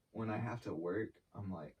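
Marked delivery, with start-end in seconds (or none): none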